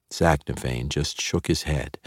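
plain reading voice

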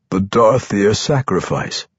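usual speaking voice

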